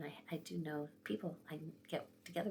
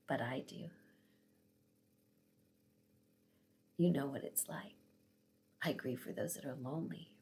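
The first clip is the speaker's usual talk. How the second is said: lower, measured voice of God, retold; gentle divine answer; 4 semitones lower than usual. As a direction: answer tenderly, with patient pauses.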